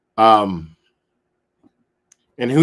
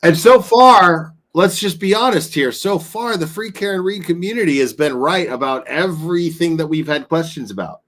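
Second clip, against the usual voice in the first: worked up; 5 semitones higher, 5 dB louder than usual. Real, not acted. Heated rant to callers.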